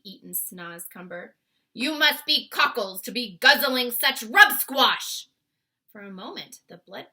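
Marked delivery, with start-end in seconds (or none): 1.7-5.3 loud slow disgusted bellow; loud giant. Acted. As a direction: roar in scorn. Bloodbottler giant voice.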